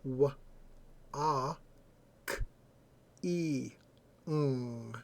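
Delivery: "W, a, k, e, ng" as five isolated sounds with long pauses; sounded out by phoneme. Shown as a demonstration. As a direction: sound out "W, a, k, e, ng" one phoneme at a time. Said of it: "there are only five phonemes".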